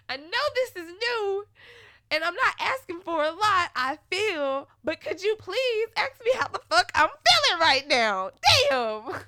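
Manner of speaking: high, loud, strained pleading; exasperated plea. Retold or imitated. Asking him to care about her feelings, a high, loud, exasperated plea. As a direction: beg him, fed up.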